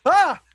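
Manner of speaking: high, loud acted cry of alarm; startled "ah". Cousin pulled into the air, acted startled cry. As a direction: yelp "ah" sharply upward. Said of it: "give out some sort of "ah" sound".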